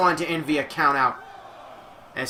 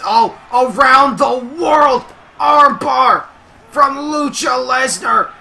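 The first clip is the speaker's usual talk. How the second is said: loud, high, stretched-out play-by-play shout; thrilled commentator at full voice; climactic excited call; 7 semitones higher, 6 dB louder than usual. Real, not acted. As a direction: belt out the finishing hold. Match-ending submission hold called.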